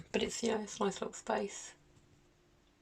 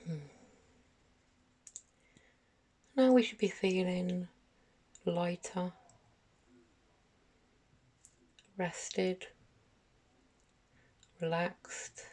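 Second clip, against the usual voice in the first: guided meditation; 3 dB quieter than usual. Acted. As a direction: gently guide them through each breath, unhurried, letting phrases settle. Leads a guided meditation for children.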